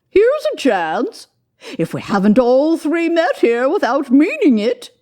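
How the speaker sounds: high, loud woman's voice; a bold washerwoman crowing to old Joe; high loud cockney-ish laundress; brash laundress. A narrator's reading. Second woman speaking.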